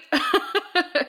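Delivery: breathy laugh at her own joke; short laugh. Real, not acted.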